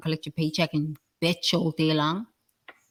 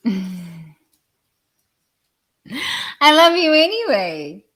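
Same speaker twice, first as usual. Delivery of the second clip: I love you anyway, pitched high, loud and slow with a laugh; high, sing-song; 7 dB louder, slower than usual. Shown as a demonstration. Speaker teasing angry listeners with a high, loud, laughing, drawn-out love you anyway.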